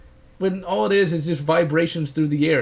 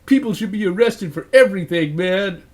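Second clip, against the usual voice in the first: mocking voice; 3 semitones higher, 4 dB louder than usual. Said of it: "people on the internet would be like".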